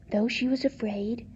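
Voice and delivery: usual speaking voice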